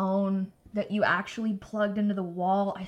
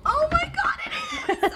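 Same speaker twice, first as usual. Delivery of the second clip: squealing high with delight; excited anime fan; slower than usual. Real, not acted. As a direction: squeal like a fan seeing merch. Sees the cute sushi cards.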